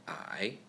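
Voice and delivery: third tone I. Shown as a demonstration.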